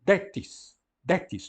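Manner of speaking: "Detis" said as one word, DE-tis; read as one word. Shown as a demonstration. Teacher shows students to read two words together, clear. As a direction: join "Detis" into a single word. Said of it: "tudo junto".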